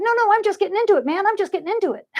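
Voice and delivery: high, fast, excited protest; eager. Acted. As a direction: refuse to stop the party. Current personified eager.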